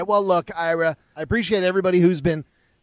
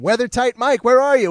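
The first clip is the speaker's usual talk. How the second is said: louder playful call to an absent sponsor; 6 semitones higher, 4 dB louder than usual. Acted. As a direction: shout it out as if hailing him across a room. Calling out to Weather Tight Mike.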